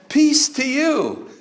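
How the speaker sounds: a calm blessing; gentle Jesus. Acted. Jesus greeting the frightened disciples, slow and high.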